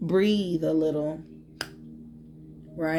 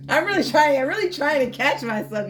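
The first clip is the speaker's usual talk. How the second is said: higher and louder, exasperated; exasperated outburst; 7 semitones higher, 5 dB louder than usual. Real, not acted. Tarot reader breaks off, exasperated at her own lecture. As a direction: burst out, frustrated with yourself. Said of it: "you guys don't understand".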